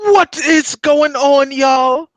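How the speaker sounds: slow, high, loud announcer-like call; big elongated sign-on, a little short of oomph; drawn-out hype greeting. Pretend. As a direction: stretch every word out high and loud. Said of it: "say it with more oomph".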